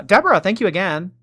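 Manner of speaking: loud, high cheerful thanks; excited shoutout. Real, not acted. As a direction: call the name out brightly.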